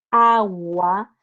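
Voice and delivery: AGUA stretched low, the U gliding into A; U and A sounded. Shown as a demonstration. Teacher repeating the example, AGUA with U and A sounded. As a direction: draw out AGUA slowly, sounding the u before a. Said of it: "donde digo la U y la A".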